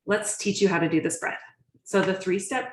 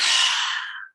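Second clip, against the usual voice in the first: a heavy forced exhale, loud and breathy; big loud exhale; 5 dB louder than usual. Shown as a demonstration. Breathwork teacher showing students an overdone exhale, loud forced sigh. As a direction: blow the breath out hard to show what not to do. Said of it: "We don't need to be like".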